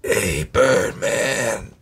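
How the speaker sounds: lightly voiced raspy jazz trumpeter, slowing down. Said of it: "I'm trying to do my [name] impression".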